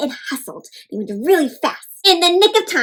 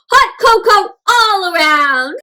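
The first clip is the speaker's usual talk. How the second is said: slow, hearty celebration call; cheerful bear boss; 6 semitones higher, 5 dB louder, slower than usual. A narrator's reading. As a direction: announce the treat, beaming.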